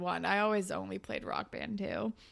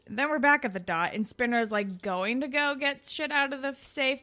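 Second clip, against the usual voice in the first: ordinary delivery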